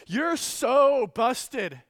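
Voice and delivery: put-on slow, gloating child's taunt, «so» drawn out; tattling kid. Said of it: "one of them will say".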